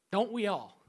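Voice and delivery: normal talking voice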